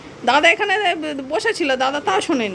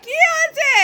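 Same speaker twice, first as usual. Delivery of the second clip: high cooing question to the cat; baby talk to a pet; 13 semitones higher than usual. Acted. (উঁচু আদুরে গলায় প্রশ্ন)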